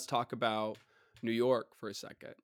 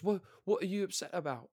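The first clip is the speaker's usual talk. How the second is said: put-on higher voice for the opponent; imitated opponent; 7 semitones higher than usual. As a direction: mimic him asking, pitched up. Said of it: "And he goes".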